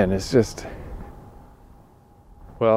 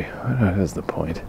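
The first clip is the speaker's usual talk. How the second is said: slow weary whisper; 5 dB quieter, slower than usual. Real, not acted. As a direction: drop to a whisper, giving up. Muttered aside.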